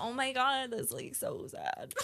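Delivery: mock sympathy. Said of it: "so I was like".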